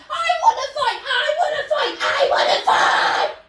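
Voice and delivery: loud high yell, laughing through it; angry fighting shout. Shown as a demonstration. Kid showing what choleric means, yelling to fight. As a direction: shout like you're itching for a fight. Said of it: "like, ... Yeah, like that".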